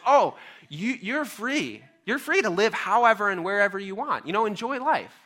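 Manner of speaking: God reassuring a little fish; gentle God voice. Acted. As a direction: give the fish freedom with open-handed kindness.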